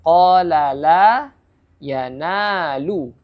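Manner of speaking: slow recited phrase; Quranic recitation. Shown as a demonstration. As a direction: draw out each long vowel. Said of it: "mat tabi'i juga".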